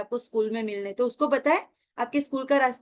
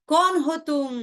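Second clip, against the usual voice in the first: higher, louder "who are you?" call; shouted challenge; 4 semitones higher, 5 dB louder than usual. Retold or imitated. Boy shouts at the unseen mocker in the hills. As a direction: yell it into the hills, startled. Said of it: "तो फिर वो बोलता है".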